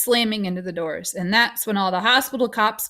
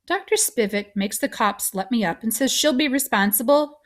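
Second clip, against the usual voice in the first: usual speaking voice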